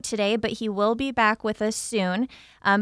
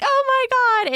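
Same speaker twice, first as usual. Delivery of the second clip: squealing high with delight; overjoyed gasp; 6 dB louder, slower than usual. Retold or imitated. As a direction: shriek it like winning a big prize. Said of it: "they're like".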